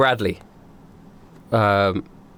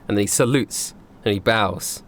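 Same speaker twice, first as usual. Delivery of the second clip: usual speaking voice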